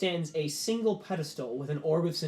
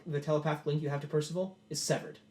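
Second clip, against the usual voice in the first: ordinary talk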